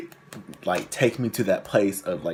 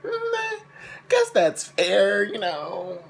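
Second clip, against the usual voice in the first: high, drawn-out squeaky concession; mock acceptance; 10 semitones higher, much slower than usual. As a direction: give in slowly in a tiny high voice. Retelling own reluctant acceptance of punishment.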